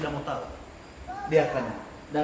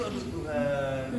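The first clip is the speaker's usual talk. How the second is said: imitated pleading prayer; 6 dB louder than usual. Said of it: "Saya kadang-kadang dulu gitu".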